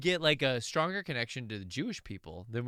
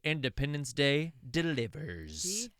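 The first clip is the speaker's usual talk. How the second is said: trailer voice; slower than usual. Acted. Reading review punchline dramatically.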